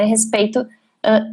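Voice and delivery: ordinary delivery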